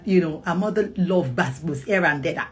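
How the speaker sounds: ordinary talk, nothing marked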